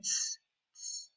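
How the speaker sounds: short voiceless tss sounds, softly; monkey hissing tss. A narrator's reading. Monkeys answer the peddler with soft hissed tss. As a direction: hiss back like the monkeys, in short bursts. Monkeys' mocking reply in story.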